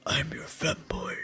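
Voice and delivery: slow, hushed femboy ASMR whisper. Acted. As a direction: whisper it slowly, low and soft. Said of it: "You wanna whisper it?".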